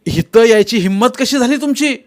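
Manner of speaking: high, heated shout; loud challenge. A narrator's reading. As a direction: demand it loudly of the intruders. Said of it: "Kumar जोरात म्हणाला".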